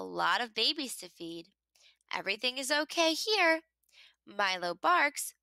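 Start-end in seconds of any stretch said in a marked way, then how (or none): none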